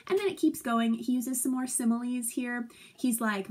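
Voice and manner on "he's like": ordinary talk, nothing marked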